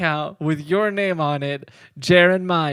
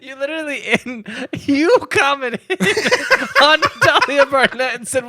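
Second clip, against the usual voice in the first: laughing; 10 semitones higher, 4 dB louder than usual. Real, not acted. Laughing while teasing co-host.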